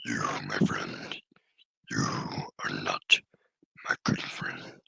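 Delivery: low, rasping whisper from the throat; creepy star whisper. Acted. Voicing the creepy star being to his viewers, raspy whisper. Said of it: "I can do that voice for a little bit".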